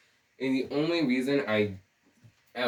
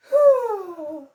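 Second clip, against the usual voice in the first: very high, loud, sustained dreamy "ooh"; exaggerated sky-high lovestruck coo; demonstrated swooning sigh; 8 dB louder than usual. Shown as a demonstration. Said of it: "I mean more like".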